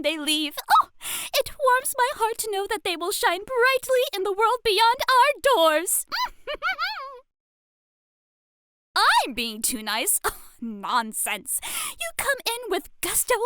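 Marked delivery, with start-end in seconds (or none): 6.1-7.1 high, quiet little laugh; soft giggle. Acted. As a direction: laugh softly, charmed. Fond teacher.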